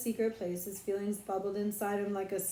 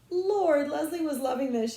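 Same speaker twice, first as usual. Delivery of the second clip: plain reading voice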